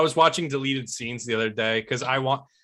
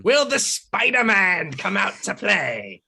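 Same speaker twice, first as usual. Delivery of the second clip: slow, high, creepy Green Goblin taunt; lightly voiced menacing villain; Willem Dafoe impression; 7 semitones higher, 3 dB louder, slower than usual. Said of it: "Best Willem Dafoe impression".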